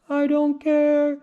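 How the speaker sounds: high, put-on lion voice; lion's careless reply. A narrator's reading. Lion answers mother, high and careless. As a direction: toss it off as the lion.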